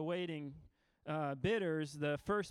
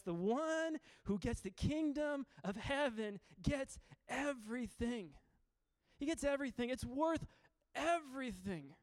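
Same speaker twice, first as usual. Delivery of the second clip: emphatic preaching; 6 semitones higher than usual. Real, not acted. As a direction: stretch each key word and let it ring before the pause. Preacher drives home the parable's point.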